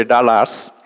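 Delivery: mock Indian Dallas, tahlahs with flat open vowels; Indian pronunciation. Comic imitating how Indians say Dallas, tahlahs. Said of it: "or as the Indians say".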